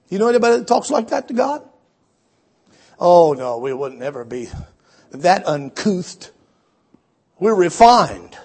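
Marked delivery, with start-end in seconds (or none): none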